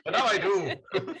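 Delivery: laughing through a high fake Irish accent. Real, not acted. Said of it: "Why have I got an Irish accent now?".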